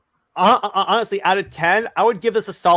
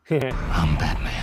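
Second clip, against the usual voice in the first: Batman growl; 13 semitones lower, 5 dB quieter, much slower than usual. Retold or imitated. Mimicking Batman voice.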